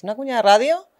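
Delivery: quick, upbeat radio spot read. Shown as a demonstration. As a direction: sell it like a radio jingle. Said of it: "oye, queremos una cuña". (voz de cuña de radio, rápida y animada)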